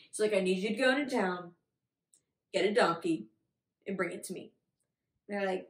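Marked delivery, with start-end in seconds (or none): 0.3-4.5 lower-pitched instructions, parcelled out with pauses; a hint of a calm leader giving orders; lightly voiced Jesus. Teacher voices Jesus sending disciples on an errand, low and matter-of-fact.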